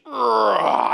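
loud growled "rrah" of effort; mock straining roar. Samson breaking the ropes, loud effortful growl. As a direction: roar as if bursting free.

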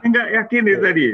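laughing while talking; high, loud laughing speech. Real, not acted. Joking the host looks different.

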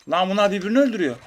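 high, loud, fast surprised outburst; excited high fast exclaim; amazed exclaim. Real, not acted. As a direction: blurt it in delighted surprise. Enemies killing each other.

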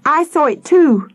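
put-on high, insistent child; crying out. A narrator's reading. Peter backing up Sam, crying out high. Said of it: "Peter cried".

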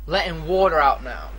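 ordinary talk, nothing marked

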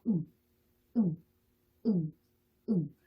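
short isolated "응" ending in a nasal ng; "응" as a Korean final-ieung sound. Shown as a demonstration. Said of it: "우리의 이응 받침 소리라고".

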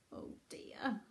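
soft unvoiced aside; whisper. A narrator's reading. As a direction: whisper it to the children.

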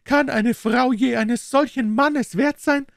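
high, rapturous Italian wife praising her husband; proud delight. A narrator's reading. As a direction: glow with pride and adoration. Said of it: "erstrahlte ihr Gesicht in Stolz und Entzücken". (stolze, verzückte Italienerin, hohe Stimme)

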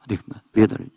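normal talking voice

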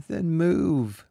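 put-on high, small child's voice; a hurt boy pushing back; tearful boy. A narrator's reading. The sobbing boy telling Jurin to move.

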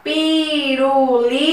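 "pirulito" drawn out slowly, syllable by syllable; syllables spelled out. Shown as a demonstration. Teacher showing the class how "pirulito" splits, slowly. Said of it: "Veja quando a gente fala".

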